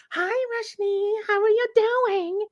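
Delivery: high, slow, sugary nurse greeting; sweet nurse voice. Retold or imitated. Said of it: "here come the freaking nurse practitioner".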